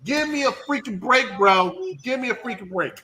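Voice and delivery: retold exasperated rant; incredulous outburst. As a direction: scoff in disbelief. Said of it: "And I'm like".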